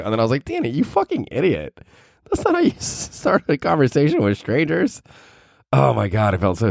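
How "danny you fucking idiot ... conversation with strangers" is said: high-pitched, exasperated self-scolding; mock self-scolding. Berating himself for starting a conversation with strangers, mock scolding. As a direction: berate yourself in a comically high voice.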